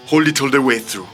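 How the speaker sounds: normal talking voice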